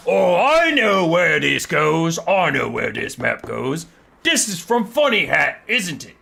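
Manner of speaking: big, loud, drawn-out ogre; ogre character voice. Acted. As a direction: bellow it slowly like a dim ogre. Acts the ogre Slaughtermaster in a story.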